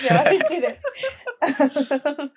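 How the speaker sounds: high laughing, drawn-out no; laughing denial. Retold or imitated. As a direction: stretch the denial out while laughing. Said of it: "I was like".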